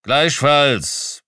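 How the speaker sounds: loud, curt return greeting; brusque worker's acknowledgement; grunted reply. A narrator's reading. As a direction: throw it back gruffly. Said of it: "brummte er".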